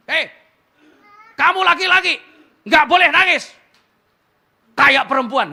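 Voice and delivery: loud high scolding; scolding father. Retold or imitated. Father scolds his crying son, loud and harsh. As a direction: bark at the boy like a stern father. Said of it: "terus papanya begini".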